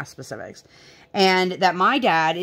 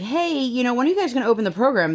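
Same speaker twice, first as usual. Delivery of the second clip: retold inquiry; 7 semitones higher, faster than usual. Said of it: "was like".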